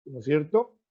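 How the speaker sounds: normal talking voice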